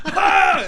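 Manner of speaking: sudden high yelp of pain, laughing through it. Retold or imitated. Retelling the car door on his finger, a high loud yelp.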